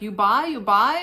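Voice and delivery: mock vendor asking again; broken English. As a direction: pester like a pushy vendor. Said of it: "she's like".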